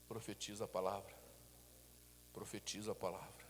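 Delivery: low, soft, slowed sermon voice; hushed preaching. Acted. Pastor softly urging the flock to prophesy, hushed preaching. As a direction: draw them in quietly, almost confiding.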